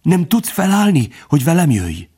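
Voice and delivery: high, thin, frantic urging; alarmed, hushed insistence; urgent pleading. A narrator's reading. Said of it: "suttogta sürgetve".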